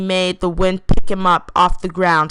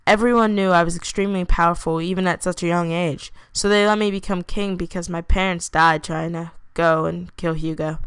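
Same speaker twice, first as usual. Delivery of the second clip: usual speaking voice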